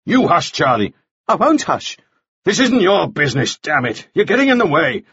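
high-pitched, irritable squabble; heated quarrel. A narrator's reading. Two men quarrel over the interrogation.

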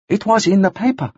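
high halting character voice; Arsuk. A narrator's reading. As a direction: state it plainly, pitched high.

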